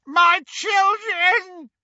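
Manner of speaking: high, slow, desperate bellow. A narrator's reading. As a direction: bellow it across the street. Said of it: "he bellowed".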